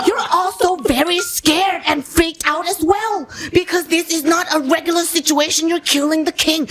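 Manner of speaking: high, urgent mock-professor coaching; professor giving notes. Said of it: "then the professor said".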